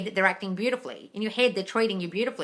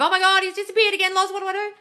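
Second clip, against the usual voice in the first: high, louder, whiny mock panic; mock panicked follower; 12 semitones higher, 7 dB louder than usual. Coach mimics followers panicking to her on a live. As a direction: parody a frantic follower. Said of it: "Then you come running to me on a live".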